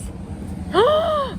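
sharp high delighted gasp; excited gasp. Real, not acted. Gasping at the bags in the hip store. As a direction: gasp at something cute.

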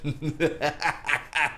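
low, soft villain laugh; gloating, sinister chuckle; evil laugh. Acted. Killer looking forward to kills, evil laugh.

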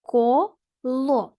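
"Коло" split into two separate syllables, Ко, ло. Shown as a demonstration. Teacher showing pupils open syllables, syllable by syllable. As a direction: say "Коло" in two slow beats with a gap between. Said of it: "якщо склад закінчується на голосну".

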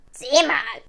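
loud raven croak; krächzender Rabe. A narrator's reading. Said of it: "krächzte nach einer Weile der Rabe". (laut krächzende Rabenstimme)

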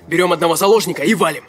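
fast, loud, pushy; urgent, loud and fast. Acted. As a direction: snap it out, urgent and rushed. Robber pushing to take hostage.

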